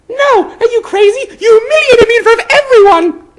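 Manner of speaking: put-on high, loud furious girl voice; high-pitched girl shouting in anger; angry Hermione. A narrator's reading. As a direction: shout him down, humiliated. Hermione refuses the hat angrily.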